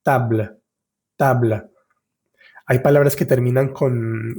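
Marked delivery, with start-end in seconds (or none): 0.0-1.6 "Table" ending on the L, e left silent; "table" with a tiny release of air after the L; silent final e. Shown as a demonstration. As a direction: say French "Table" only as far as the L. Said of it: "no la estamos pronunciando".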